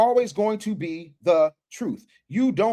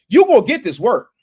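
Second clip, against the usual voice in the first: quoted battle-rap taunt, louder and higher; punchy, pitched-up catchphrase; 4 semitones higher, 6 dB louder than usual. Retold or imitated. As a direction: throw it out like a battle rapper's threat. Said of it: "one of my favorite battle rappers, [name]".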